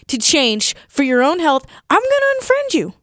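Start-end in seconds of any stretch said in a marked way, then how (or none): 1.9-2.9 high-pitched ultimatum; indignant, suddenly much higher; indignant threat. Acted. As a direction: deliver the threat with pitch jumping way up. Delivers final threat in intervention.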